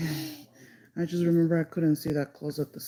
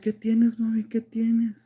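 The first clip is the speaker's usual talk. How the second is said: higher, louder imitation of a concerned mother; worried mom; 6 semitones higher, 4 dB louder than usual. Mom asks her crying child what happened, imitated worried voice. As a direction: retell mom's urgent fretting.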